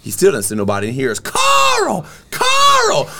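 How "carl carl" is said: very high falsetto screech, slow and loud; angry scolding yell. Acted. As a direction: scream the punchline like a furious scolding. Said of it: "he heard something go".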